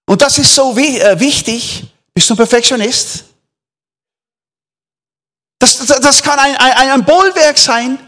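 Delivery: full-force sermon delivery; fervent preaching. Acted. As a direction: drive the point home to the church. Preaches to congregation.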